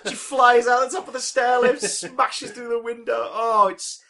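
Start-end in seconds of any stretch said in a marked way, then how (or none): 3.3-4.0 slow, drawn out praise; savoured. Real, not acted. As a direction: savour every word of it. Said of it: "relishing the film".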